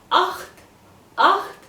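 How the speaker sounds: Acht high and short with a rasped ch; half a sneeze. Shown as a demonstration. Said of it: "This is like half a sneeze".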